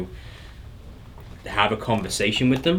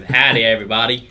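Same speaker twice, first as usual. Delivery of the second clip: loud Texan greeting; jokey Texan accent; 8 dB louder than usual. Pretend. Co-host greets everyone for a laugh, loud Texan accent. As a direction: holler it with a playful Texan twang. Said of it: "That's [name]'s English, American, Texan accent".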